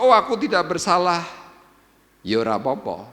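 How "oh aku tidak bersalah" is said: loud mock protest. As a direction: shout it out, high and loud. Preacher mocks self-righteous claim. (seruan keras pura-pura tak bersalah)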